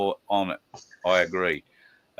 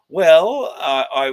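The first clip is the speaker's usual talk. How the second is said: 1 playful intro; 9 semitones higher, 10 dB louder, much slower than usual. Real, not acted. Playful big intro before guest.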